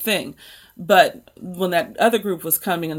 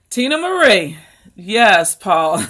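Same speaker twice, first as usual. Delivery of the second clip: excited shout-out; 2 semitones higher, 8 dB louder, slower than usual. Real, not acted. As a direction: greet viewers with a burst of joy. Greeting chat viewers by name.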